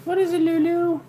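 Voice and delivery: very high, sing-song pet voice. Real, not acted. Owner cooing at her dog Lulu in a high baby voice.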